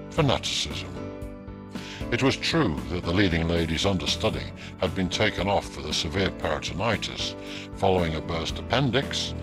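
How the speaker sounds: ordinary delivery, nothing marked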